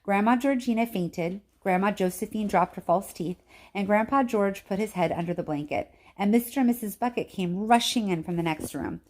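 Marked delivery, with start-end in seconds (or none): none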